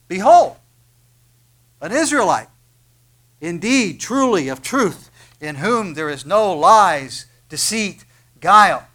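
loud, slow proclamation of Jesus's words; ringing loud announcement, drawn out; proclaiming Jesus. Acted. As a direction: boom it out like a herald. Jesus proclaims Nathanael's honesty aloud.

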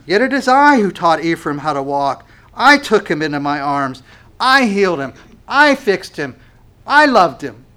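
loud, rising pulpit cry; impassioned preaching. Acted. Preacher voicing God's love to the congregation, loud and fervent. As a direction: proclaim it with full force from the pulpit.

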